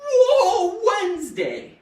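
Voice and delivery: high, loud, drawn-out whoa; big playful holler; excited whoop. Shown as a demonstration. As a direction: whoop the catchphrase with a laugh in it. Show's catchphrase called out.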